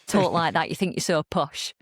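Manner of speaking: imitating relatives' regional English accent; mock teasing in a home regional accent; family's regional accent. Family member teasing about posh speech, regional accent. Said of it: "certain members of my family".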